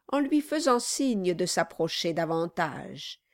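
ordinary delivery, nothing marked

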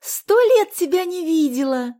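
high, drawn-out joyful exclamation; delighted. A narrator's reading. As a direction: exclaim slowly with overjoyed emphasis. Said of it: "обрадовалась Соня".

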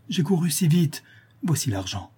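mildly flustered, high then dropping; a touch of faltering haste; stammering. A narrator's reading. Daughter hands over the money, lightly stammering. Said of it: "répondit Louise en balbutiant".

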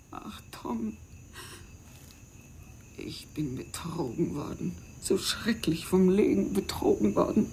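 soft, broken whisper to Tom; despairing whisper. Acted. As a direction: breathe it out as a confession. Confides her despair to Tom. (verzweifeltes, leises Flüstern)

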